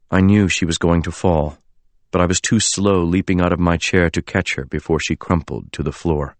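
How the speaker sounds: ordinary delivery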